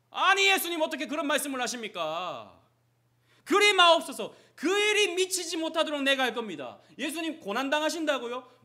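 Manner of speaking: Peter's indignant protest. Acted. Peter protesting to Jesus, loud indignant rebuke. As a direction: plead hard, as if grabbing his collar.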